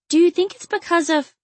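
high, tentative question; hesitant heroine. A narrator's reading. Aziza starting a question she need not finish, hesitant. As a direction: ask high and leave it unfinished.

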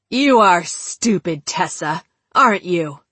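mocking taunt. A narrator's reading. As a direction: sneer at the victim, taking your time. Killer taunts her in figure voice.

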